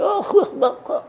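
put-on drunken cackle. Drunk moonshiner laughing in dark.

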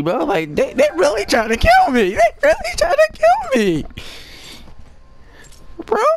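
squealing falsetto complaint, very high; comic high-pitched panic. Acted. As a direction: whine it at the top of your range, hammed-up panic. Comic panic at being targeted.

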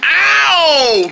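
high, stretched-out ow; pretend ow. Character hit by a thrown guitar, high drawn-out ow. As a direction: yelp in play pain, sliding down.

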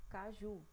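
Caju with JU held longer; stress on last syllable. Shown as a demonstration. Teacher showing the longer syllable, Caju stressed on JU. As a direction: lengthen the JU of Caju. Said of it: "Ju já demora mais".

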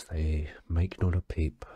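ordinary talk, nothing marked

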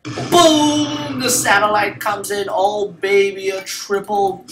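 drawn-out high BOOM, then gloating; loud victory call, easing off as it goes; triumphant hype. Real, not acted. Gamer hyping his own triple, triumphant shout.

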